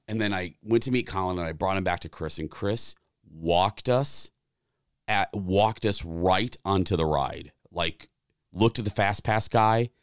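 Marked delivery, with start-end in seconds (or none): none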